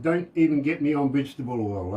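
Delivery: normal talking voice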